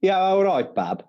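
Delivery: Brummie, high then dropping; playful Birmingham greeting; Birmingham accent. Acted. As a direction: greet in a put-on Brummie lilt. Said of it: "go for a Birmingham accent".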